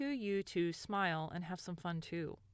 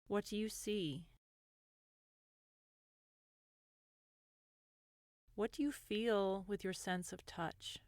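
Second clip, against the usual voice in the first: gentle guided meditation voice, lingering between phrases. Acted. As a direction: ease the listener into calm, unhurried.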